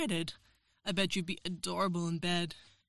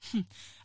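breathy laugh. Acted. Teasing threat.

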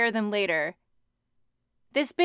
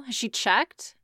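ordinary delivery

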